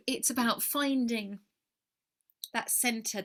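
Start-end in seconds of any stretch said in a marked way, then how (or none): none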